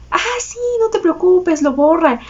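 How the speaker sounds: lightly voiced kind clerk, no big deal; friendly clerk. The clerk reassuring her about the wrong digits, high and easygoing. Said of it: "súper buena onda".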